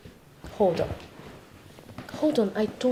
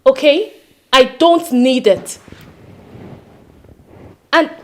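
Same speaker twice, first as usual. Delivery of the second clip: loud, raised-pitch firm refusal; insistent refusal; 5 semitones higher, 14 dB louder, slower than usual. Acted. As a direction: push back forcefully, voice raised. Wife firmly refuses husband's offer.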